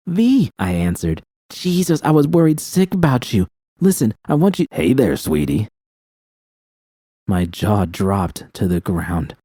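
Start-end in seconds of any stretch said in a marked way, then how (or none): 0.1-4.7 high and urgent, worried sick; worried rush. Acted. Narrator answers, worried sick. 4.7-5.7 menacing deep voice. Acted. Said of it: "The deep voice that came from the speaker".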